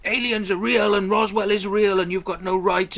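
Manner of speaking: high, loud parody of a ranting believer; mocking stalker rant. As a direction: ham up her frantic insistence. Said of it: "She's like".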